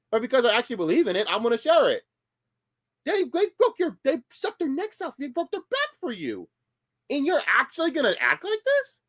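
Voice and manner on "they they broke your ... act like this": worked up. Real, not acted. Outraged defense of gym owners.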